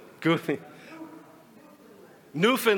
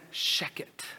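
whispered sheket, breath only; hushed sheket; 8 dB quieter than usual. Shown as a demonstration. Pinchas explaining sheket, whispered hush.

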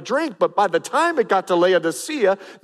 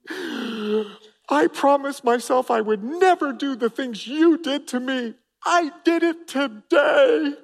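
a touch of tearful sorry, higher pitched; apologetic grown child; 7 semitones higher, slower than usual. Retold or imitated. Grown child phoning dad, mildly tearful apology. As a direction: confess to dad with a catch in the voice. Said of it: "I've gotten the phone call".

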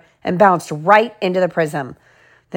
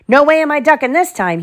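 defiant; 7 semitones higher, 3 dB louder than usual. A narrator's reading. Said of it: "he said".

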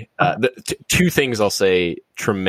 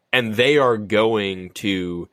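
ordinary talk

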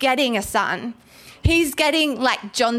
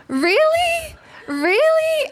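much higher and louder, falling disbelief; hammed-up squeak of disbelief; incredulous; 3 dB louder, much slower than usual. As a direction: exaggerate the disbelief for the crowd. Preacher mocking Zacharias' doubt.

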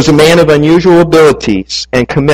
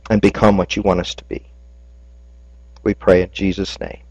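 soft, low pastor's prayer; closing prayer; 6 semitones lower, 3 dB quieter than usual. Acted. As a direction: pray gently over the congregation.

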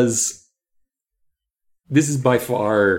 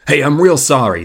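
louder, higher character apology; earnest read-aloud apology; apologetic man; 4 semitones higher, 8 dB louder than usual. A narrator's reading. Driver apologizing to the kids, acted sorry.

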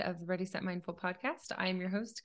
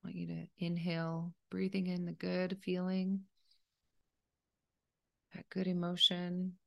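softer, even meditation voice with long pauses; calm, unhurried meditation leader; guided meditation; 4 dB quieter than usual. Acted. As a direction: gently guide a listener through breathing, leaving space between phrases.

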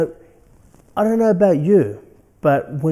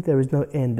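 ordinary talk, nothing marked

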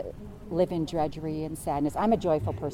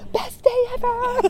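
much higher, slow, laughing squeal; gleeful high-pitched joy; thrilled squeal; 5 dB louder, slower than usual. Real, not acted. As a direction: squeal with delight. Thrilled at beating Bezos.

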